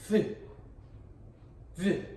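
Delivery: "th" said alone as a single sound; isolated th sound. Shown as a demonstration. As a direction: sound out "th" by itself. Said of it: "That's an important sound".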